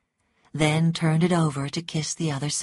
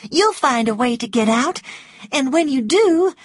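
higher, louder eager woman; excited sweet talk; 9 semitones higher, 7 dB louder than usual. A narrator's reading. Scarlett flatters the jailed Rhett, sweet and excited. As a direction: flatter him in a high, excited voice. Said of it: "it was difficult to talk sweetly".

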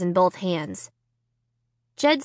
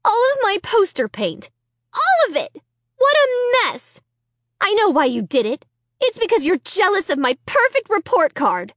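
high, accusing sister; whiny, furious accusation; outraged; 11 semitones higher, 3 dB louder than usual. A narrator's reading.

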